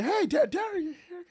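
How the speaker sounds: 1 surprised greeting. Retold or imitated.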